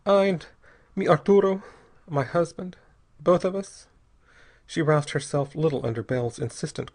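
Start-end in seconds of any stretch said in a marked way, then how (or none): 0.0-3.9 higher, halting woman's voice; name each one haltingly, resigned; defeated, broken-up phrasing; apathetically. A narrator's reading. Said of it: "she told him apathetically".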